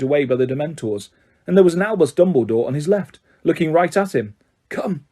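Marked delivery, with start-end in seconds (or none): 4.7-5.1 softly, gently; a quiet summons; soft invitation. A narrator's reading. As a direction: Dumbledore gently leading Harry. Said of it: "said Dumbledore".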